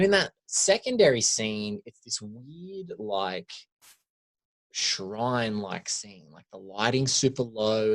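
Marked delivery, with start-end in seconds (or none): none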